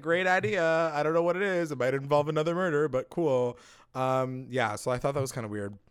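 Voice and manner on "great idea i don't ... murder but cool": higher, chirpy mock agreement; breezy cheerful impression. Tony agreeing to invite Cricket, mock breezy.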